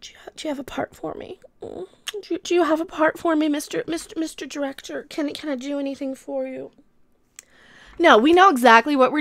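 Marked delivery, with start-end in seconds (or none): 0.0-6.7 soft, whispery come-on to a director; hammed-up breathless whisper, hesitant and suggestive; coy actress pleading. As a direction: play a coy starlet angling for a part, very hushed. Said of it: "go into a room and say".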